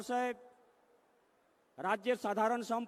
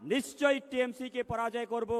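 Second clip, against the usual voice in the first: ordinary talk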